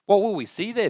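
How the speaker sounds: put-on excited child's pitch; eager little one. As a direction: ask it bursting with curiosity.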